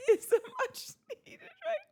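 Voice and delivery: laughing. Real, not acted. Cracking up reading comment.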